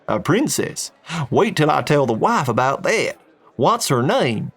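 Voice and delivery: eager Texan wanting gossip for the wife; lively Texan curiosity; Texan, excited. Acted. Texan excited about princess.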